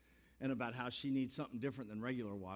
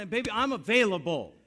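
much louder, higher, a showman's cry; loud playful exclamation; 9 semitones higher, 15 dB louder than usual. Real, not acted. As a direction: belt it out to the congregation with a grin. Pastor's playful exclamation to crowd.

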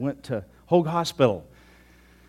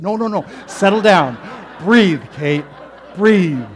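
loud, high urging to breathe; loud calming commands; 3 semitones higher, 11 dB louder, slower than usual. Retold or imitated. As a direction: urge her loudly to settle and breathe. Calming panicking woman, loud.

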